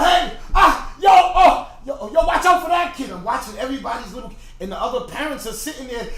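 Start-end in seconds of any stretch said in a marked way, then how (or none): 0.0-3.1 loud, high shouts to stop; urgent calling out, much louder; shouted warning. Retold or imitated. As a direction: yell across the playground to head off a collision. Acts out calling to kids.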